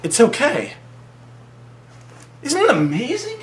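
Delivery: hammed-up smitten wonder; awestruck reassurance. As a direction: coo at the creature, enchanted. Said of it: "he's like".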